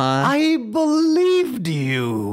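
very high, very slow mock wail; hammed-up wounded woman. Duped woman to crutch pranksters, long high mock wail. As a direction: stretch each word into a hammed-up lament. Repeats the poor woman's reproach, bigger.

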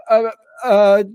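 nervous stammer; stuttering. Retold or imitated. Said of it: "I was just stuttering".

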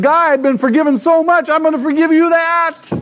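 loud, high, hammed-up proclamation; exuberant acted forgiveness. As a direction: act it out at a high-pitched shout. Acting out the forgiven servant.

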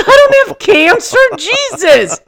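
high, loud denial broken by laughter; laughing denial. Retold or imitated. Husband denying cancer through laughter, high and loud. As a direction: laugh it off in disbelief.

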